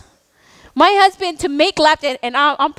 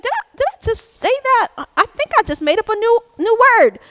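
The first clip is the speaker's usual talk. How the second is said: mock surprise; 6 semitones higher than usual. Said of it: "I'm just like".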